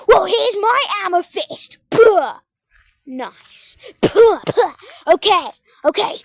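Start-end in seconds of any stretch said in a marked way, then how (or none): 0.1-1.8 loud, high taunting comeback; hammed-up fighting comeback; mock threat. Shown as a demonstration. Shows punchline for the bully. 1.9-2.3 loud puffed punch sound; explosive pretend thump; punch noise. Imitates a punch. 4.0-4.7 two loud puffed hits; explosive pretend thumps; punch noises. Imitates punches.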